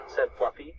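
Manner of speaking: ordinary delivery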